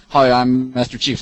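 normal talking voice

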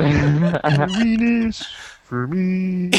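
high, slow froggy croon; squeaky puppet voice. Pretend. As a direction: sing it like a puppet frog. Continuing the squeaky voice bit.

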